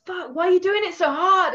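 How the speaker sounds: normal talking voice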